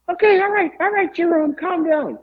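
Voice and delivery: very high, nervous placating voice; mock Howard. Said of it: "so Howard says".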